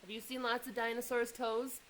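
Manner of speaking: plain reading voice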